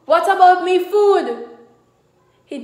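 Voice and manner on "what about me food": angry husband. Acted. Husband demanding his food.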